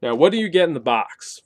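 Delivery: ordinary talk, nothing marked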